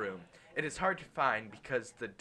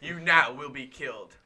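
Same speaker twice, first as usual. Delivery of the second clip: louder booming delivery; 7 dB louder than usual. Acted. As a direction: boom it like a judge. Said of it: "said a booming voice".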